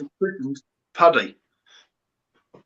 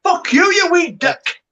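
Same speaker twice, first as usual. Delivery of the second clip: higher, slow mock Scottish insult; 13 semitones higher, slower than usual. Mock Scottish insult thrown at a friend in banter. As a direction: play a cheeky Scot telling him off.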